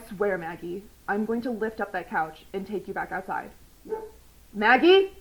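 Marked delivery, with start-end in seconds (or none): 4.5-5.1 calling the dog. Real, not acted.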